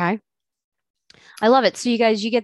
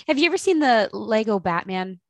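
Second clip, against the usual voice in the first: usual speaking voice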